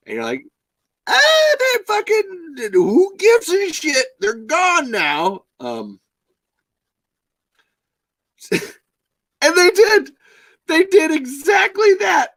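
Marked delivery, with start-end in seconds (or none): 1.1-5.5 very high, loud, drawn-out mock holler; slow, squealing send-up of not caring; mock dismissive yell. Host mocking makers who dropped the old cast, dismissive yell. 8.5-12.3 very high, loud, drawn-out cry; indignant shouting. Real, not acted. Podcast host railing at what the makers did, indignant shout.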